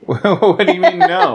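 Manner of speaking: high, loud mock outrage; mock indignant. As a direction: protest in playful disbelief.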